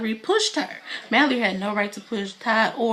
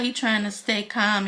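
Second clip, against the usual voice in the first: usual speaking voice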